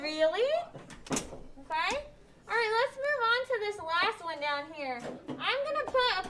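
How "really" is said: "Really" rising at the end; question. Shown as a demonstration. Teacher models question mark, questioning rise. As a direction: ask it, lifting the last syllable. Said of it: "read this as a question".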